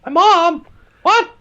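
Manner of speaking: put-on squeaky high child calling for dad; high-pitched child voice asking dad; whiny little kid. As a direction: play a needy little child begging dad for answers.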